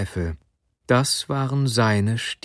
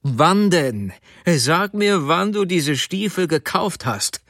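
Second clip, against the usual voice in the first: higher, insistent interrogation; angry demand; 6 semitones higher than usual. A narrator's reading. Husband demanding when she bought the boots, angrily. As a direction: press her hard for an answer.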